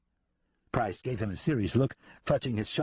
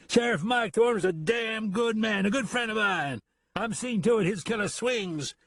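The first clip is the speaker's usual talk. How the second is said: put-on angry drunken voice; 11 semitones higher than usual.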